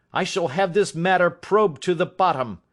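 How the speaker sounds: grief and rage. Acted. Father vowing to probe the theft, in grief and rage. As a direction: swear it through grief and rage. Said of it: "half mad with grief and rage".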